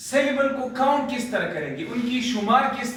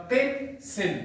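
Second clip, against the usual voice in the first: "Pencil" in two parts with a short break; broken into syllables; 3 semitones higher than usual. Shown as a demonstration. Teacher breaking the word into sounds, "pencil" split in two. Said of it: "broke करूंगा".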